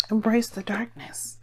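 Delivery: slow eerie whisper; hushed, drawn-out whisper. Pretend. Spooky whispered mantra.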